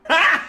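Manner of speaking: sudden very high, loud yelp; shocked yelp; startled yell. Real, not acted. Sudden shock during play.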